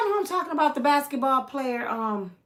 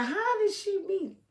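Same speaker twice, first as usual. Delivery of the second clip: high quoted question; 11 semitones higher than usual. Retold or imitated. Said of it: "'Cause I kept saying".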